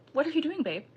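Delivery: high-pitched mock-innocent boyfriend; feigned nonchalance. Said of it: "as if everything's normal, like, just nonchalant".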